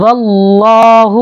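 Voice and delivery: "واللہ" with the V run straight into a thick, dark L; heavy L. Shown as a demonstration. Teacher showing how lam is read, "واللہ" with a heavy L. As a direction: chant "واللہ" slowly, fattening the L. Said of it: "لام کو موٹا کر کے پڑھیں گے".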